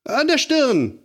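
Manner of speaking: a lightly acted hurt little girl; whimpering. A narrator's reading. Lotta tells Aunt Berg where it hurts, whimpering. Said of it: "sagte sie".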